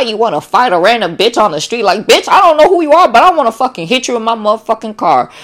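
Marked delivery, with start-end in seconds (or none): 1.8-5.3 mock road rage. Drained person snapping at a stranger, mock fury. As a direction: play at wanting to fight a random driver.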